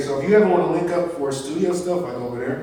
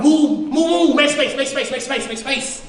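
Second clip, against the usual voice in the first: imitating urgent coach; 7 semitones higher than usual. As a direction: push the players back. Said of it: "It comes to Antonio like".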